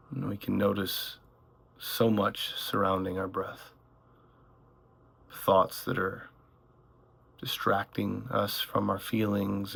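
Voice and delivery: calm meditation voice, gradually softening; unhurried phrases with long silences between them; guided meditation. Acted. Guide leading listeners through one breath at a time, guided meditation.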